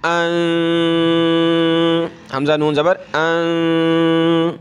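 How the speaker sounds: अं hummed through the nose; nasal ghunna. Shown as a demonstration. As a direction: let अं resonate in the nose. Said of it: "नाक में आवाज़ पैदा करेंगे".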